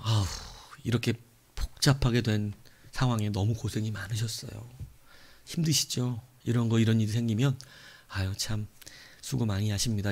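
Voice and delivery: politely commiserate while swallowing anger; forced kindness. Retold or imitated. Angry customer kindly consoling airline staff at refund, soft forced politeness. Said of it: "화가 나지만 웃으면서".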